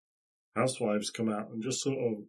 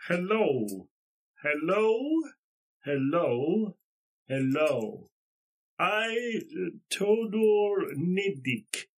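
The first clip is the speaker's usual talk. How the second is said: high, slow broken-English greeting; halting foreign villager; 5 semitones higher, 4 dB louder, much slower than usual. Acted. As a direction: feel out each word like a villager with little English. Plays local man with little English.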